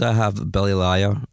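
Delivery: usual speaking voice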